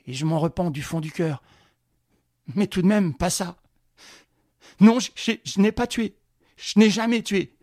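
trembling voice. Acted. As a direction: plead for your life, voice shaking. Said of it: "répondit d'une voix tremblante".

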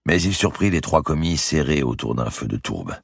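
ordinary delivery

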